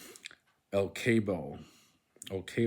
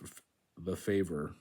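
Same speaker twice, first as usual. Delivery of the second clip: ordinary delivery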